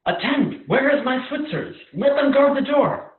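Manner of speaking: loud, high call for the guards; alarmed shout. Acted. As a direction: bellow for the guards in panic. King calls his guards in alarm.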